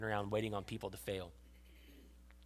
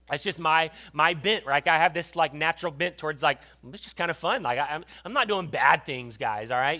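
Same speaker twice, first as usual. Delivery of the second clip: loud, higher mock self-justifying; mock defensive; 5 semitones higher, 10 dB louder than usual. Preacher mocking his younger self, loud and defensive. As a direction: playfully defend yourself to the guys.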